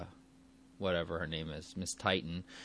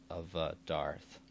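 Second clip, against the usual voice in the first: usual speaking voice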